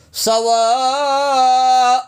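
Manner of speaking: سواء with the vowel stretched about five counts; stretched madd on سواء; long madd. Shown as a demonstration. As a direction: pull سواء out long and slow. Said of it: "کھینچ کے پڑھیں گے".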